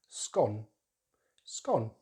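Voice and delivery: scone as skon, falling; short vowel. Shown as a demonstration. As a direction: rhyme scone with gone, British style. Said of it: "use a short vowel sound".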